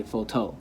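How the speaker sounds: PHOTO wrongly stressed on the end, foh-TOH; stress on last syllable. Shown as a demonstration. Teacher showing the other choice, photo stressed on TOH. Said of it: "or you could say".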